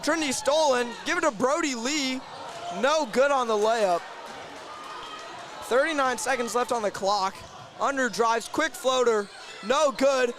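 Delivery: excited basketball commentary; urgent courtside sportscaster call; live play-by-play. Real, not acted. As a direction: call the fast break as it happens, voice lifted.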